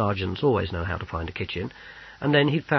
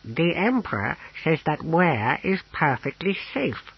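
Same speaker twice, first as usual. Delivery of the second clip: higher-pitched character voice, reporting earnestly; naive Owlglass, passing on news; 7 semitones higher than usual. A narrator's reading. Companion relaying the Emperor's assurance, earnest and high. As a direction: put on a high voice for a reassuring report.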